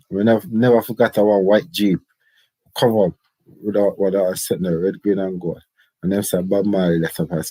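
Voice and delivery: ordinary delivery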